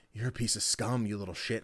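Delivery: low, soft imitation of a menacing character; Meg. Voice actress insulting fans on request, low soft menace. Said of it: "in the Meg voice".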